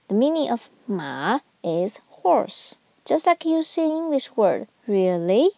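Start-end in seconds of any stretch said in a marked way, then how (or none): 4.9-5.5 "really" dipping down, then up; falling-rising tone. Shown as a demonstration. Teacher likening third tone to "really", down then up. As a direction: swoop "really" down and back up. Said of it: "You go down and up".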